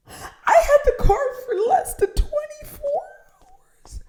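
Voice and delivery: incredulous at the rental charge; outraged disbelief. Real, not acted. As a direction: squeal it in disbelief.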